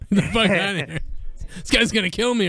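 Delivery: a hint of a panicked boss; mock Vince. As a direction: shoo him off through laughter. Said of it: "He'd probably be like".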